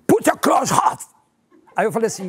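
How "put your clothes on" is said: very high mock American order; guard orders him, in a high mock American voice; guard's order. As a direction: bark it like the guard, hammed-up. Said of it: "Aí eles falaram assim".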